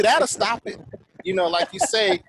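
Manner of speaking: ordinary talk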